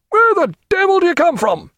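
high shouted demand; irate hail. A narrator's reading. Senior captain demanding answers of junior, high irate hail. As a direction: shout across, outraged. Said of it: "hailed Miller".

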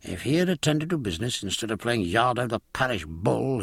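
usual speaking voice

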